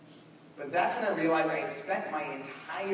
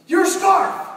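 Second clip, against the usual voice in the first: much higher, louder blurt; blurted exclamation; 14 dB louder than usual. Retold or imitated. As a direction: panic and blurt it out. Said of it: "I'm like".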